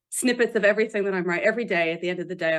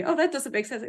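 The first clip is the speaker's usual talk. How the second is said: lightly pitched-up doubter; a higher, mildly mocking quote; mock objector; 6 semitones higher than usual. Author quoting people who complain to them, light mock objection. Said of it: "people say to me".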